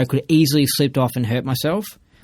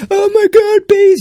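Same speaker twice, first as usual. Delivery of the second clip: shrill, much higher mock squeal of panic; 7 dB louder, slower than usual. Mocking scared bystanders, much higher panicky squeal. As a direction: ham up a terrified bystander. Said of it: "other people around those bees would be like".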